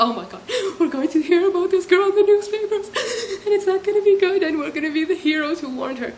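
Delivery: high, hammed-up gushing with laughter; mock excitement. Imitating the women imagining newspaper fame, mock excitement. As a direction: gush as if it's thrilling gossip.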